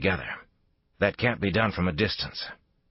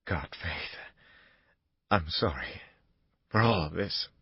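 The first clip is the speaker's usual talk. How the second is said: broken, whispered apology; barely holding it together, voice cracking; a choked near-whisper of regret. Acted. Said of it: "Buchanan's voice cracked in mid-sentence".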